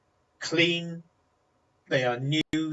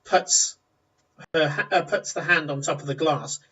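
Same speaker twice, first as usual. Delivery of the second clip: normal talking voice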